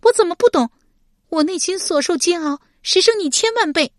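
high, loud anguished shout; angry. Acted. Shi Pei angrily answering Deng Yi, loud shout. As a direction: cry out her own suffering. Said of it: "史佩怒道".

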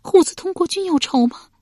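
high, loud wifely challenge; distressed high questioning; challenging retort. A narrator's reading. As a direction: throw the question back at him. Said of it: "妻子反问道".

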